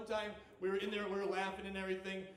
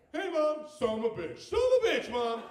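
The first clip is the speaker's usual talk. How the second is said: loud mock-child call, leaping high; excited kid; 7 semitones higher, 11 dB louder than usual. Kids greet Mom as door opens, loud imitated excitement. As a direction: shout the curse like a proud toddler.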